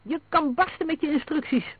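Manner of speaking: high-pitched indignant outburst; defiant, heated shouting; angry roar. A narrator's reading. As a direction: throw it back in fury, voice high. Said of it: "brulde Ross".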